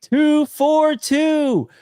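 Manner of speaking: high, drawn-out celebratory call; mock yell, each number stretched out; crowd yelling. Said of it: "everybody was, like, yelling".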